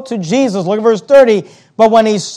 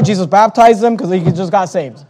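usual speaking voice

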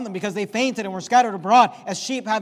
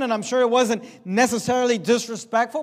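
normal talking voice

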